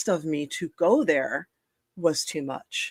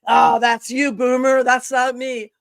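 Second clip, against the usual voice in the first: higher, louder imitation of a cocky youngster; mock youthful dismissal; 7 semitones higher, 6 dB louder than usual. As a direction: wave off the elder, hammed up. Mock-quoting dismissive twenty-year-old.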